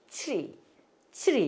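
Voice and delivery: 트리 as one-syllable "chree"; English-style "chree" for 트리; corrected English pronunciation. Shown as a demonstration. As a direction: model 트리 with blended "chr". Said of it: "이렇게 읽으시는 거예요".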